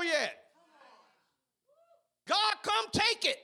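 high, loud, shouted church testimony; preaching at full force. Acted. Pastor rousing the church, shouting his testimony. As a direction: call it out, rousing the congregation. Shouted sermon climax, God's voice quoted.

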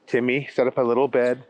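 usual speaking voice

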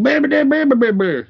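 high, loud gibberish yell; mock yelling gibberish. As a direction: blurt nonsense syllables. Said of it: "He's like".

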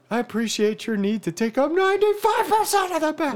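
high, sing-song passive-aggressive voice; sing-song mock appreciation. To wife hogging the bed, high sing-song mock. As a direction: sweetly needle her. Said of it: "I still would be like".

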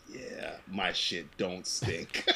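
soft, slow, smug drawl; lightly voiced cocky boss; smug overlord. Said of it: "that's going".

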